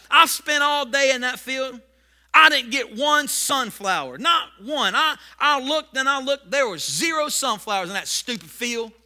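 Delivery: loud, slow indignant farmer's complaint; angry farmer. Acted. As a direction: rant about the empty field.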